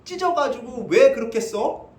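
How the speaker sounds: high, stretched-out mock bafflement; incredulous objection. Listener questioning why clothes were torn in four, incredulous. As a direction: act out a puzzled reader's protest. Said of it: "우리가 이렇게 생각하기 이럴 수 있어요".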